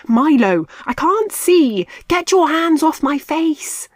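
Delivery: Marty blinded by Milo, yelling; calling out. A narrator's reading. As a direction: holler at Milo. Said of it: "Marty called out".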